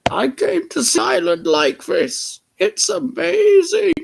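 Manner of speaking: put-on very high, loud shrieking old lady; shrill lady exclamation. Missus Kindly calling out to Harold, high and loud.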